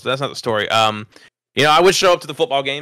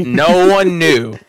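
amused emphasis; 6 semitones higher, 6 dB louder, much slower than usual. Real, not acted. Punchline about the nickname.

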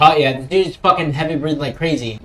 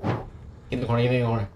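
ordinary talk, nothing marked

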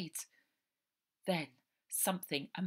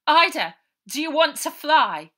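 higher, louder call across the runway; 9 semitones higher, 15 dB louder than usual. Acted. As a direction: call to her from a distance. Harry calling across.

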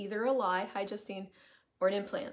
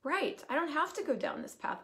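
light realization; 6 semitones higher than usual. Retold or imitated. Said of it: "I'm like".

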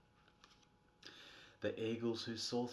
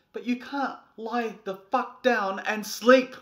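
loud, higher angry shout; exasperated rant; 10 semitones higher, 13 dB louder than usual. Acted. Frustrated parent shouting at the sleepless child. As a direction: rant in disbelief at the kid.

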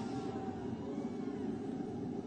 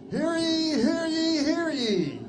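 town crier; 8 semitones higher than usual. Acted. Groundhog Club opening proclamation.

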